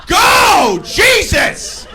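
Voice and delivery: high, very loud impatient yell; screaming driver. Retold or imitated. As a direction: scream it out the car window, stretching the words. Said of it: "Screaming out his window at me".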